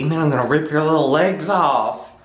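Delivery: usual speaking voice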